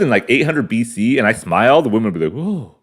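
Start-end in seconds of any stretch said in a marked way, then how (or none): 2.3-2.8 impressed gasp. Retold or imitated. Ancient women admiring his smile.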